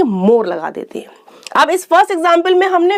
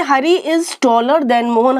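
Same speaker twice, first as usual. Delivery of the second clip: slow example; much slower than usual. Shown as a demonstration. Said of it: "अब अगर हम कहते हैं".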